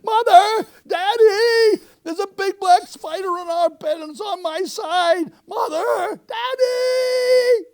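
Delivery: very high, loud panicked scream. Acted. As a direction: shriek for your parents in a fright. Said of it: "went screaming into her mother and father's room".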